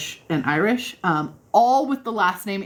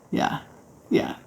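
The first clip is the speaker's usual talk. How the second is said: ordinary talk, nothing marked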